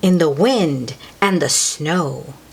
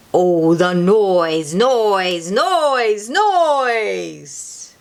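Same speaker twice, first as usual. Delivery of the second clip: building noise; much slower than usual. Acted. Telling children about the Grinch's dreaded noise, building up. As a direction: wind up the noise into a big cry.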